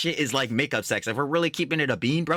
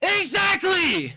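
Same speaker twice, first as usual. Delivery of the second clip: long, high, stretched shout of agreement; yelling agreement at the clip, long and high; drawn-out shout; 4 dB louder, much slower than usual. Real, not acted. Streamer agrees with the clip, stretched high shout.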